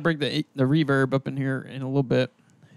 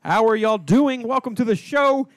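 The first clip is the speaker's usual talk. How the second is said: announcer voice; 6 dB louder, slower than usual. Acted. Opening the show as announcer.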